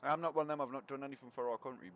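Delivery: ordinary talk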